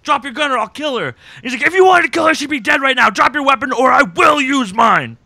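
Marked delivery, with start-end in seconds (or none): 0.0-1.1 acted threat. Acts out a hostage threat. 1.6-5.1 loud, high, dramatic standoff ultimatum; forceful threat. Retold or imitated. Host retells Jack facing the gunman, forceful.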